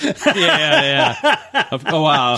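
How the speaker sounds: high, laughing yeahs; giggling through the words. Real, not acted. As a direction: laugh along while agreeing. Both laughing at the joke.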